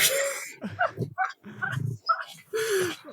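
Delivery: long squealing wheeze of a laugh; real high-pitched laughing fit; high wheezing laugh. Real, not acted. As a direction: cackle helplessly high. Laughing at friend.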